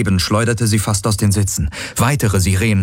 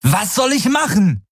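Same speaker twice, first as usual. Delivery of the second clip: high exasperated yell; angry, desperate shout; 13 semitones higher than usual. A narrator's reading. Galen answers crewmate's demand, angry desperate cry. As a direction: fire back in high-pitched despair. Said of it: "ohne seine eigene Wut oder Verzweiflung zu verhehlen".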